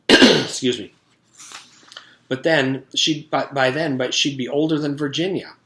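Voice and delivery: lively read-aloud storytelling voice; story narration. A narrator's reading. Teacher reading a chapter to the class, lively storytelling.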